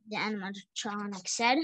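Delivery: usual speaking voice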